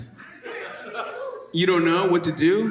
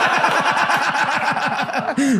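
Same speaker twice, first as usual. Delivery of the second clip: a high bursting laugh; 10 semitones higher than usual. Real, not acted. Comic laughing at his own joke on stage.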